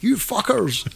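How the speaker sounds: high, hammed-up LA accent; mock LA accent. Imitating an angry LA listener at the computer, high mock LA accent. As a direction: squeal it in a badly faked LA accent. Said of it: "That was my L A accent".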